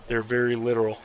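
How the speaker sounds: normal talking voice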